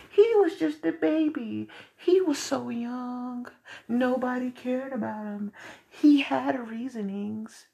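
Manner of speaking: high, slow, pitying voice mocking the media; sarcastic high pity voice; mocking sympathetic voice. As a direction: whine out their excuses in a high pleading tone. Mocking media excuses for shooters.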